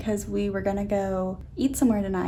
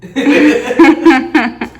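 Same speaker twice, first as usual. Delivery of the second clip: laughing; 3 semitones higher, 14 dB louder than usual. Real, not acted. Laughing at romantic mood.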